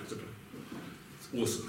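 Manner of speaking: slow, breathy hush of wonder; awed whisper. Real, not acted. Teacher marveling at the Gemara to his class, in an awed slow whisper.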